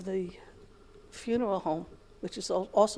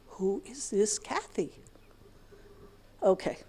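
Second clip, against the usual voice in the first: slow whisper; slow exasperated whisper to herself; whispered aside; 8 dB quieter, slower than usual. Real, not acted. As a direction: hiss the question under your breath. Exasperated aside about Kathy.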